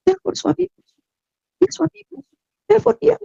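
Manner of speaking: the voice within, gently insisting; inner voice. Retold or imitated. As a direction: speak as the voice of her heart. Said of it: "seperti ada suara yang bicara".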